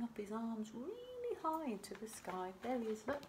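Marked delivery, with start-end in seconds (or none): none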